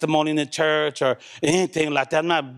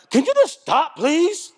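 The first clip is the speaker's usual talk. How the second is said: higher, exasperated mock plea; pleading partner; 10 semitones higher, slower than usual. Partner begging the angry arguer to stop, higher and exasperated. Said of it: "the other person's like".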